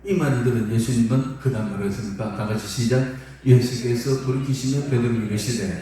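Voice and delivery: usual speaking voice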